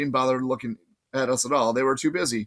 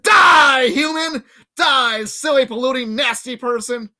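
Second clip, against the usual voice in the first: high, loud battle yell; satyr gamer shout; 11 semitones higher, 7 dB louder, slower than usual. Acted. Grover yelling at the game, high and loud. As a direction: blast the virtual humans.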